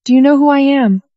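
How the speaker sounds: high, strained yell. A narrator's reading. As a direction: yell it while struggling. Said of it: "struggling and yelling".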